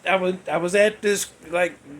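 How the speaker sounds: ordinary talk, nothing marked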